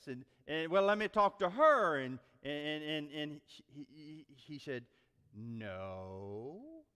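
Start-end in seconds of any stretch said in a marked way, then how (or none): 0.7-2.0 louder, higher phone demand; acted boss pushing to get an adult; insistent boss. 5.3-6.8 put-on low, soft, drawn-out child answer; whispering little boy. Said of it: "the small little voice whispered".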